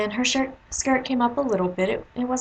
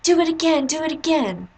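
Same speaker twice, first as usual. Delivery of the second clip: put-on eager kids egging on; 7 semitones higher than usual. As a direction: cheer her on to spin again.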